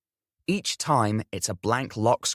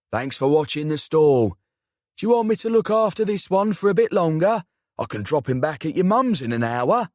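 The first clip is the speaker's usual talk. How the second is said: put-on South London accent; 4 semitones higher, 4 dB louder than usual. A narrator's reading. Uncle voiced in story.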